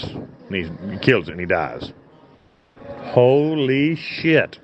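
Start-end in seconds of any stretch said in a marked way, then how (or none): 3.0-4.6 slow, drawn-out holy shit; awed, slow exclamation at the killing; stunned. Real, not acted. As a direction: let the words hang in disbelief. Shock at the cop's killing.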